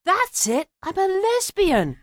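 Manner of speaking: high-pitched, drawn-out declaration; revelation. Retold or imitated. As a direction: announce it as a sudden discovery. Retold moment of sudden realisation.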